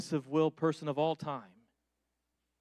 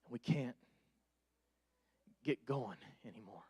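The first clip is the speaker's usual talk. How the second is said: much softer, lower, intimate appeal; soft earnest preaching; 8 semitones lower, 10 dB quieter than usual. Acted. Preacher speaking softly to the weary, tender and low. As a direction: lean in and speak gently to hurting listeners.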